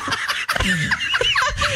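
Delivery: a high, breathy burst of laughing; high burst of laughter. Real, not acted. Host cracking up at her co-host's eyelid, real laugh. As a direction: break into helpless laughter.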